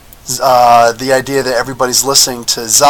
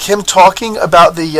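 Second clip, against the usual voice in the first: usual speaking voice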